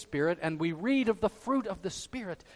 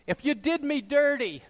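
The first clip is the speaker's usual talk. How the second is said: raised, higher-pitched accusation; indignant grievance; 7 semitones higher, 5 dB louder than usual. Acted. Preacher acts out a self-pitying victim lashing out, indignant. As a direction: hurl it out like a wronged victim.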